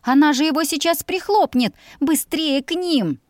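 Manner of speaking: high, urgent and alarmed. A narrator's reading. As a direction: urge him on. Heroine urging in alarm.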